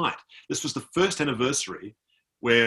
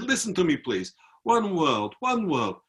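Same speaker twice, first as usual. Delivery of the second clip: slow, earnest retold plea; imitating emotional soldier; 6 semitones higher, slower than usual. Soldier insists tourist listen, imitated emotional plea. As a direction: beg to be heard, drawing words out. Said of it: "he got quite emotional".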